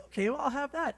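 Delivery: high-pitched imitation of a wife; put-on high voice, giving in to a suggestion; Monica. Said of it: "And she'll say".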